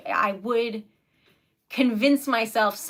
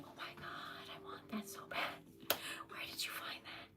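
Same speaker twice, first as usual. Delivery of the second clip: breathless, awed whisper; whispering her envy to a viewer; excited whisper; 20 dB quieter than usual. Real, not acted. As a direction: whisper it like you can't believe it. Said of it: "I'm freaking out".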